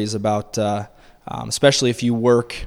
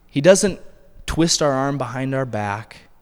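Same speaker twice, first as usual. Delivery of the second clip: ordinary delivery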